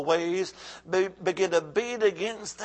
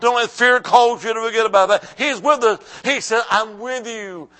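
loud, insistent sermon delivery; forceful preaching; 6 semitones higher, 10 dB louder than usual. Real, not acted. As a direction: drive the point home to the congregation.

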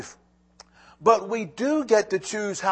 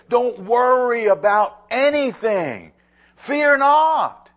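forceful scripture; 7 semitones higher, 7 dB louder, slower than usual. Acted. Preacher quoting Paul with force.

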